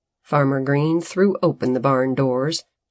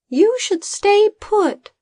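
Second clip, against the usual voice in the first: high, urgent little voice; Bodo in haste; 4 dB louder than usual. A narrator's reading. Assistant stopping the farmer from looking outside, high urgent voice. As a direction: hold him back with a quick high warning. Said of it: "he said in haste".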